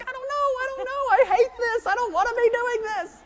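very high, loud, hammed-up wail; panicked outburst. Pilot yelled at over the radio, panicked outburst. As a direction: melt down in mock panic.

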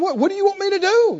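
high, fast mock-irritated complaint; half-mocking irritated whine; irritated retort. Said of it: "actually get irritated and go".

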